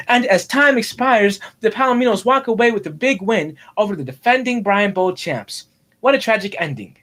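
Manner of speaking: sportscaster wrapping up a game; football play-by-play. Pretend. Boy narrating his toy league's final, dramatic play-by-play. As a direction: announce the final seconds with drama. Calls end of imagined game.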